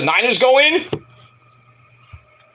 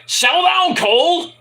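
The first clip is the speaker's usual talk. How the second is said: imitating Corey; 10 semitones higher than usual. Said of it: "Corey goes like".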